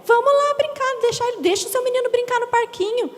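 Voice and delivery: high, fast, insistent coaxing; pushy churchwoman. Retold or imitated. Churchwoman coaxing the mother into the playroom, fast and pushy. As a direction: sweep her along before she can refuse. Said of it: "Aí ela falou assim".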